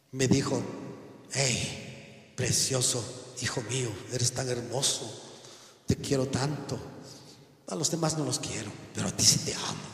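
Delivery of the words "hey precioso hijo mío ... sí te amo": soft, low doting voice; playing God whispering sweet favour; mock tenderness. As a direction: coo it quietly like a doting father, mockingly. Mock quote of God doting on him.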